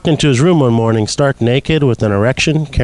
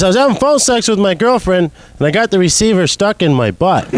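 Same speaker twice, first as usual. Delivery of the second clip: raised voice repeating it; shouting down phone; 7 semitones higher than usual. Retold or imitated. Repeats louder for plumber.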